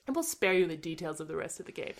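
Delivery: ordinary talk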